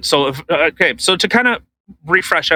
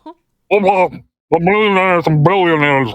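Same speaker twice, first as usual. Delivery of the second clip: mock Bernie Sanders, marble mouth; Bernie Sanders impression. Host mocking Bernie Sanders to his co-host, marble-mouthed voice. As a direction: mush the words like an old senator. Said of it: "That's what he sounds like".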